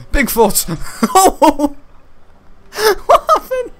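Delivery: high loud laughing cry. Real, not acted. Gamer laughing at being stomped, high laughing cry. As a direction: laugh through the words.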